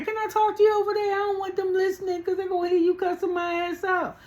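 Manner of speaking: high, loud mock-weepy wail; hammed-up crying voice, held very high. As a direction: imitate a tearful plea in a squeaky high pitch. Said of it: "Rosie was crying".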